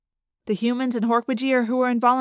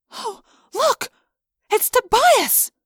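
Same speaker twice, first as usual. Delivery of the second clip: high, excited cry of recognition; amazed call; 13 semitones higher, 3 dB louder, slower than usual. A narrator's reading. Narrator spots Tobias in the frozen cavern, amazed call. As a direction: exclaim in high-pitched astonishment, drawing out the name. Spotting lost friend, amazed.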